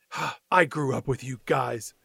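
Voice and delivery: low, quiet disgusted judgment; acted disgust. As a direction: voice a kid let down by his friends, low and hushed. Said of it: "looks so disgusted at his friends".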